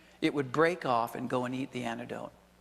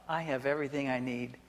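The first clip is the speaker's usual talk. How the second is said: normal talking voice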